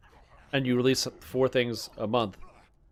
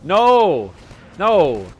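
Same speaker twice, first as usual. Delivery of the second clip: loud protest; 5 semitones higher, 9 dB louder than usual. Real, not acted. Protesting bully accusation.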